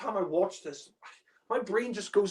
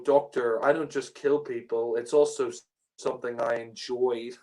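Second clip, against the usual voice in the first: mock hammy agent; 6 semitones lower than usual. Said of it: "the acting there is so bad".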